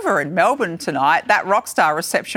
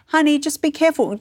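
raised, sweet caution; gentle warning; 8 semitones higher than usual. Retold or imitated. Another parent sweetly warning kids at school, lilting. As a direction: lilt it kindly to a child. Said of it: "be like".

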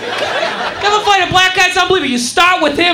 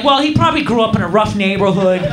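low, measured, reasonable voice; mock-understanding calm; 8 semitones lower than usual. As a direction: parody earnest, forgiving sympathy, pitched well down. Excusing the insulter as understanding passengers.

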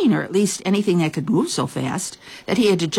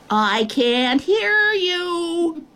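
high, slow, drawn-out distant call; shouting back; 12 semitones higher, much slower than usual. A narrator's reading. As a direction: stretch each word across the distance. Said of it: "the Nobble shouted back".

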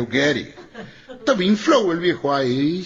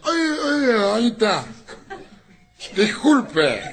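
put-on drunk apology; 5 semitones higher, slower than usual.

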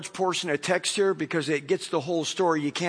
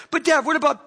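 daughter's voice; 8 semitones higher, 5 dB louder, faster than usual. Retold or imitated. Daughter starts again.

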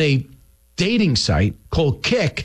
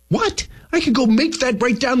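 sharp, high disbelieving yelp; mock-shocked what; incredulous what; 7 semitones higher than usual. Real, not acted. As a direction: react like it's absurd. Host mocks gym ID voting rule.

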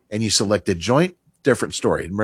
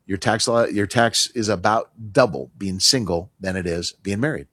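usual speaking voice

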